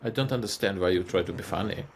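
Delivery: put-on humorless, baffled German accent; stern mock-German. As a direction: deliver it deadpan as a puzzled German. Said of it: "the Germans and Austrians have a hard time".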